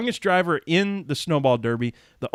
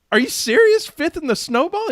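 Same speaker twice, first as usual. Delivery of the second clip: much higher, excited squeal of disbelief; amazed disbelief; 13 semitones higher than usual. Retold or imitated. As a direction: replay your own stunned reaction, pitched way up. Retelling own amazement to uncle.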